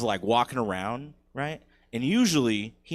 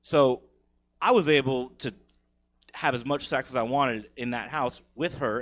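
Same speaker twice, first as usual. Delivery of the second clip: ordinary delivery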